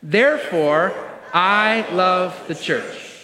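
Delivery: slow, drawn-out group declaration; leading in unison. Real, not acted. Pastor and church all say it together as the logical conclusion, slow and deliberate. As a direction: land each word deliberately, stretched for all to join. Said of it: "Here we go".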